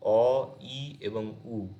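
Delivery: very slow, each vowel drawn out; slow vowel demo. Shown as a demonstration. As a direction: say each short vowel slowly and clearly. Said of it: "যেগুলো হর্ষ স্বর". (প্রতিটি স্বর ধীরে ধীরে উচ্চারণ)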